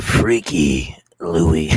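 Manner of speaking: very slow, deep trailer voice; movie announcer voice. Pretend. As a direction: drag it out like a trailer. Repeats in trailer voice.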